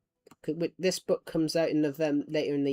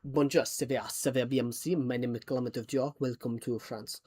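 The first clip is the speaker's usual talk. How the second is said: lower, put-on French accent; 3 semitones lower, 3 dB quieter than usual. Shown as a demonstration. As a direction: welcome visitors with a French accent. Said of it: "Do a French accent".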